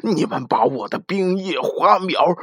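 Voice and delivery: loud, slow upset complaint. Acted. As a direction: spell out the damage, upset. Ben upset explaining.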